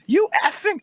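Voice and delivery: high, loud mock squeal; cursing old woman. Old woman cursing at the guard, high mock outrage.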